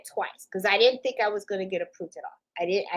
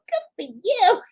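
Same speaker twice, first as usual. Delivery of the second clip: imitating the lady; 10 semitones higher, slower than usual. Lady congratulating him voiced.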